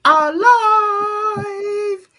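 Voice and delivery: high, loud, drawn-out show opener; excited announcement. Real, not acted. Host opening the live show, slow and loud excited announcement. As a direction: announce it like a big show starting.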